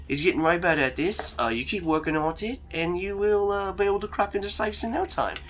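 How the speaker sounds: high, encouraging Australian voice; keen Aussie pep talk; Australian accent. Acted. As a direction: coach a mate along in Australian. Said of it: "Don't know why he's Australian now".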